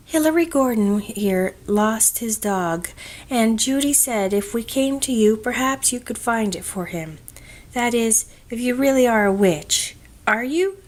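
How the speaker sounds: put-on a bold child putting her question straight; earnest girl. A narrator's reading. Pat asks the witch to find the lost dog, eager and earnest.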